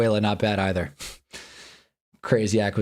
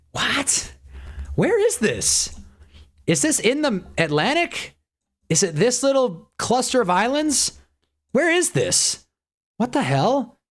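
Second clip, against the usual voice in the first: baffled high squeak; 12 semitones higher, slower than usual. Real, not acted. Lost guessing an unknown country.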